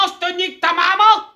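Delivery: normal talking voice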